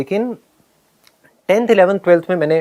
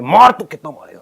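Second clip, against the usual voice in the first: raised-voice dare; mildly shouted provocation; retold loud challenge; 2 semitones higher, 5 dB louder than usual. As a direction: re-enact a loud, defiant taunt. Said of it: "बहुत ज़्यादा एक loud voice में".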